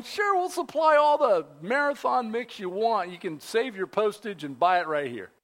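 ordinary delivery

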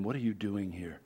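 quiet voice of God. A narrator's reading. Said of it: "It was not a human voice".

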